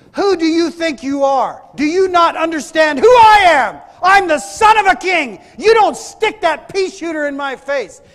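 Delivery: full-force, high indignant shouting; angry shout. Retold or imitated. Buddy shouting back at gunman in his face, acted angry shout. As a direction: shout him down in outrage, imitating the friend. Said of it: "he started shouting at him".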